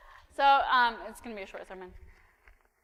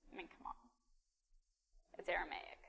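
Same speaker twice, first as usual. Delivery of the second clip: breathy whispered aside; a hushed aside to the crowd; 4 semitones lower, 14 dB quieter, faster than usual. Acted. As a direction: drop to a secret whisper. Dismissive aside to congregation.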